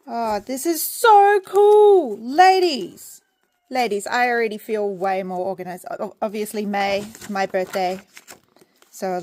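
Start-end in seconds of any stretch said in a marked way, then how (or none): 0.1-3.2 high, loud, drawn-out delight; excited gushing. Real, not acted. Praising the ladies who made it, excited. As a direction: gush loudly, stretching the words.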